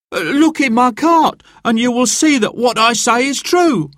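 high, pleading voice of a scared poor man; frightened butcher. Acted. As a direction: beg to be let go.

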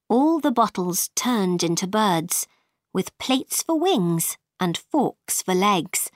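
ordinary delivery

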